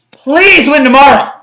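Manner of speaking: desperate plea; pleading. Real, not acted. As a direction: beg the team to win.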